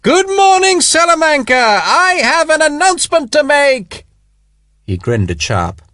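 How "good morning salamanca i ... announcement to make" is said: very high, loud public bellow. A narrator's reading. Spiers bellowing at the shuttered windows to wake the town. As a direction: bellow it to the whole plaza. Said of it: "bellowed at the shuttered windows".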